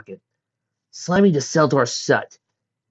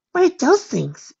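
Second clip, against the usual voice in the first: high, slow wondering voice; curious young colt; 5 dB louder, slower than usual. A narrator's reading. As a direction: ask in wide-eyed wonder.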